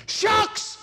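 high, sharp cry of alarm; alarmed exclamation. Retold or imitated. Woman bursting in to stop the preacher, alarmed cry. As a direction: yelp it out in alarm. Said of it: "I can't say exactly what she said".

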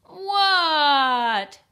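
high, loud drawn-out shout back; yelling. A narrator's reading. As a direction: frog yelling back from the snow. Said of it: "yelled Froggy".

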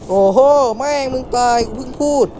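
high, loud cry of disbelief; shocked outburst. Real, not acted. Gamer yelling at the character's sudden death, in shocked disbelief. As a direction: yelp as the character dies just after the warning. Character dies right after he warned.

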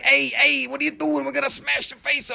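hammed-up gangster menace; mock mafioso threat. Seller imagines a buyer threatening people with glass, mock mafioso threat. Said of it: "you mafiosa".

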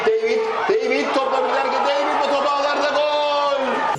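rising to a stretched goal cry; excited goal call. Real, not acted. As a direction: build up and shout the goal.

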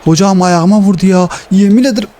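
indignant yelp; outraged complaint. Real, not acted. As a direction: cry out at the foul. Complaining of a foul.

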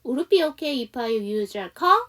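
"if I use your car" slow with a rising end; slow model reading. Shown as a demonstration. Teacher slowly rereading the question for students. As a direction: read it slowly and let it rise at the end. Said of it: "제가 천천히 다시 한번 해보면".

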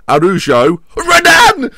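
yelling names at full voice, the last one very high; loud shout. Real, not acted. Streamer yelling at his goalkeeper, loud shout. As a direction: bellow for the keeper to save it. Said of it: "I've shouted loudly".

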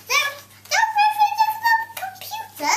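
very high, silly squeak; squeaky high voice. Acted. Silly voice for the poem's ending.

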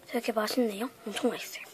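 talking while chewing; words through a chewy mouthful; chewing gum. Real, not acted. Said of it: "쩝쩝 소리 들리셨나요?".

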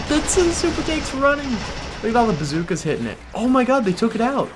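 high-pitched, loud excited commentary; real astonished high yell; excited disbelief. Real, not acted. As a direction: call the upset as it happens, voice shooting up. Astonished at tank being destroyed.

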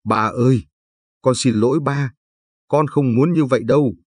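daughter pleads with father through tears, rising voice; a touch of sobbing, pitch climbing. A narrator's reading. Con gái khóc nức nở van xin ba. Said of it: "mà nức nở nói".

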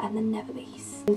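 soft whispered list item; whispering. Real, not acted. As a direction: whisper it like a secret. Listing films, drops to whisper.

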